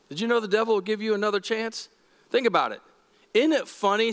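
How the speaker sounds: ordinary talk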